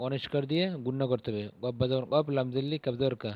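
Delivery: ordinary talk, nothing marked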